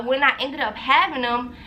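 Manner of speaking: ordinary delivery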